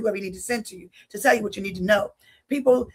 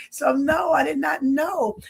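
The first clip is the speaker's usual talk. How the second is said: slow, emphatic no with raised voice; firm insistence; 3 semitones higher, slower than usual. Retold or imitated. Insisting to the coworker, a slow emphatic denial. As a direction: spell it out, every word firm.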